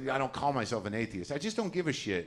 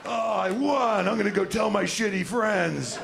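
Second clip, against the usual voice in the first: smug; 8 semitones higher, 4 dB louder than usual. Retold or imitated. Smug atheist after winning.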